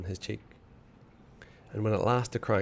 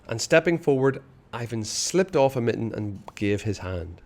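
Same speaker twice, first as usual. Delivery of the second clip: usual speaking voice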